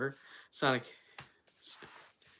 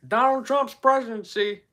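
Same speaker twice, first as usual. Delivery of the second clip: raised, high-pitched politician impression; Joe Biden; 8 semitones higher, 4 dB louder than usual. Streamer acting out the debate, high Biden voice. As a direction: push the voice up like a debater. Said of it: "I could do a good Joe Biden".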